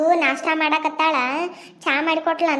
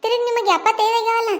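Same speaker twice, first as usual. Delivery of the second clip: scolding shout; 3 semitones higher, 5 dB louder than usual. Acted. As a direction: shout her down in the quarrel. Daughter-in-law scolds mother-in-law. (ಅತ್ತೆಗೆ ಜೋರಾಗಿ ಗದರುವುದು)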